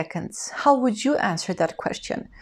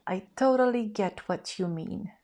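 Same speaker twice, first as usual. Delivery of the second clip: whisper; 5 dB quieter than usual. Shown as a demonstration. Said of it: "unvoiced whisper".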